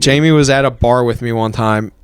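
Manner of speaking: ordinary delivery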